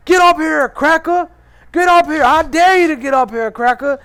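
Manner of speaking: high, loud hostile yelling; imitated screaming stranger. Imitating a man turning on him on the bus, screaming. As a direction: holler threats like an aggressive stranger. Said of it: "he starts screaming at the top of his lungs".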